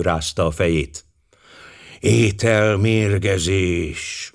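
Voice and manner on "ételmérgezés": slow, drawn-out "Ételmérgezés"; pensive. Acted. Poirot thinks aloud to Hastings, slow and pensive. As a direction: ponder the word slowly. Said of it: "mondta töprengve".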